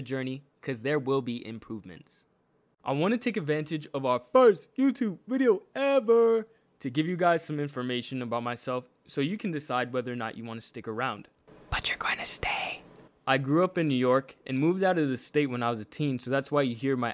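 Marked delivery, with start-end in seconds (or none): 4.3-6.5 mock-grand hype. Hyping the channel's first video. 11.7-12.8 whispered aside to the viewer; hushed, all-breath whisper; conspiratorial whisper. Acted.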